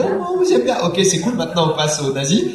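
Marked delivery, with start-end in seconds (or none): 0.0-0.8 high, sweet little creature voice; cute character voice. Retold or imitated. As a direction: coo it like a cuddly Maya. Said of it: "dis un truc gentil".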